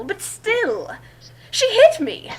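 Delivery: whiny mock indignation rising to a squeal; mock outrage. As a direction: squeal the complaint like the victim. Reading indignant first-person fanfic.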